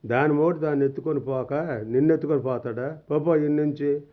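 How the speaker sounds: usual speaking voice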